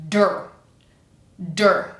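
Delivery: "Der" with no vowel between d and r; isolated "Der", proper hard d; D straight into er. Shown as a demonstration. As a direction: say "Der" as one firm syllable. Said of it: "like D and er with nothing in between".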